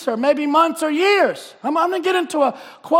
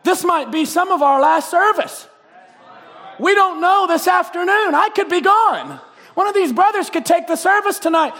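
building shouted proclamation; fervent preaching; 2 semitones higher than usual. Acted. Preaching at full force.

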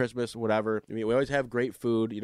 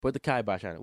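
usual speaking voice

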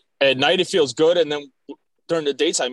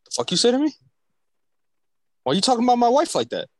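higher-pitched imitation of a coworker snapping; mock-serious confrontation; 8 semitones higher, faster than usual. Coworker confronting whoever walks in, mock-serious. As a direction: pretend to take offense, dead serious. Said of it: "he'll do it so serious".

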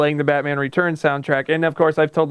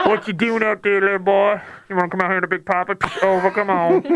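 big trucker calling over the CB; hammed-up trucker on the radio.

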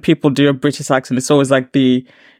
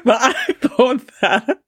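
talking through a laugh, higher and slow; 10 semitones higher, much slower than usual. Real, not acted. Panellist answering after a joke, laughing. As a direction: push the words out through real laughter.

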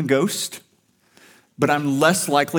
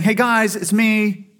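breezy, offhand, and pitched higher and louder; casual mock Jesus; 8 semitones higher, 5 dB louder than usual. Jesus casually greeting startled disciples, mock breezy. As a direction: toss it off like a friend who just popped by. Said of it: "He's not just saying".

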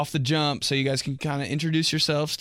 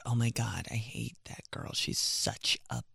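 much softer, dropping to a whisper; catty whisper; 2 semitones lower, 10 dB quieter, slower than usual. Retold or imitated. Girl at the bank badmouthing someone, whispering. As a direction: hiss the gossip under your breath. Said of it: "she would change her voice like that".

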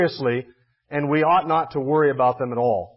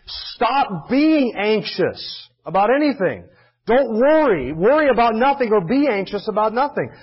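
raised, insistent preacher's command; emphatic command; 4 semitones higher, slower than usual. Acted. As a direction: order anxious listeners to stop, weighting each word. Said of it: "It's also the present imperative".

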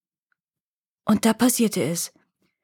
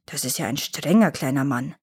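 put-on lower, grumbling old troll; slow deep simple brute; 6 semitones lower than usual. A narrator's reading. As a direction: rumble it like a dim brute. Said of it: "brummte der Troll".

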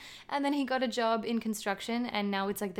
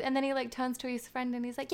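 ordinary delivery

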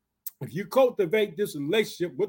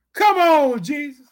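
high, loud burst that drops off; exasperated protest; 6 semitones higher, 7 dB louder, slower than usual. Acted. Woman rebuffed by Jesus objects, exasperated protest.

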